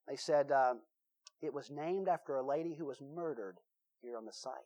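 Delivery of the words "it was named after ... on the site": spooky hushed telling. Retold or imitated. Counselor telling spooky story.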